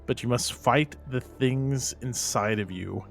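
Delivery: slow, formal in-character address; measured command, weighing each phrase; solemn commanding investigator. Acted. Investigator ordering possessed men to resist, solemn and commanding.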